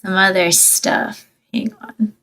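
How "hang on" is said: soft unvoiced whisper. Real, not acted. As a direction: whisper it under breath. Whispered aside.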